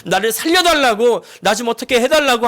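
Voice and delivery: half-shouted cry of "살려달라고" with "살려달라고" pushed high and loud; crying out to God. Retold or imitated. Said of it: "하나님께 소리소리를 지르고요".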